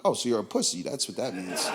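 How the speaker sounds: dry put-down. Retold or imitated. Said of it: "I'm like".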